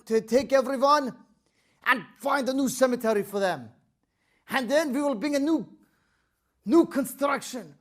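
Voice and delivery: loud commanding governor proclaiming an order; stern official decree. Acted. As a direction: read the king's order with authority. Governor sets the deadline.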